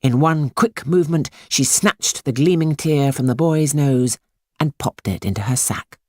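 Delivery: ordinary delivery, nothing marked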